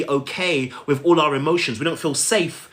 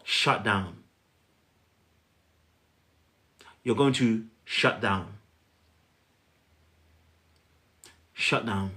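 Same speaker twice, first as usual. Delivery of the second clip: quiet shutdown; 6 semitones lower, 7 dB quieter, much slower than usual. Shown as a demonstration. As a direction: sink the voice low and soft, letting it die away. Shutting down when overwhelmed.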